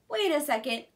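ordinary delivery, nothing marked

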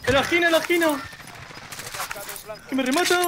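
panicking gamer. Real, not acted. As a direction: scream for help before getting finished. Downed, enemy about to finish.

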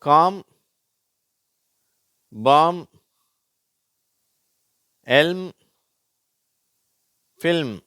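"காம், பாம்" with silent L, "எல்ம், ஃபில்ம்" with L sounded; high, separate "காம், பாம், எல்ம், ஃபில்ம்", long pauses; LM sound demo. Shown as a demonstration. Teacher contrasting LM words, "காம், பாம்" without L, "எல்ம், ஃபில்ம்" with it. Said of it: "எல் silent ஆயிடுது".

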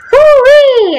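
high-pitched drawn-out whoop; dismayed whoop. Real, not acted. Gamer sees it's already 1938, high dismayed whoop. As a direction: let out a high "woo-wee". Reacts to bad date in game.

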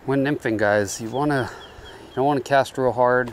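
normal talking voice

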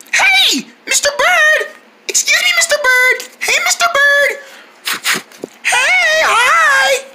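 high-pitched hail to a bird; calling out. Acted. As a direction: call out high to get its attention.

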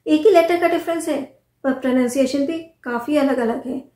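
ordinary talk, nothing marked

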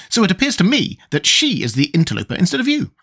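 high-pitched insect character voice; high, emphatic storybook bug; opinionated Wogglebug. Acted. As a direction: argue the point in a high voice.

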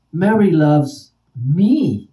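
slow, loud, ME punched and falling; stress on ME. Shown as a demonstration. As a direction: save the big emphasis for "me". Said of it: "Same words, different nuances".

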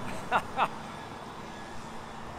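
soft, high chuckle; quiet, airy laughter; laughing. Real, not acted. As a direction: laugh to yourself, delighted. Amused after telling the story.

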